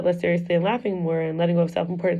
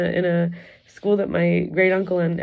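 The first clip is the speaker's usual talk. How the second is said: ordinary delivery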